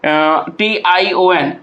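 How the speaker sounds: slow spelling in syllable groups; letters spaced out slowly, with long gaps. Shown as a demonstration. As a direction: break the word into spelled syllables, pausing between them. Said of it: "तोड़ते हैं किस तरह से".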